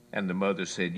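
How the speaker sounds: ordinary talk, nothing marked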